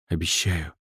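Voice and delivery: soft hushed promise; whispering. Acted. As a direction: breathe it close to her ear. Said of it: "прошептал он ей".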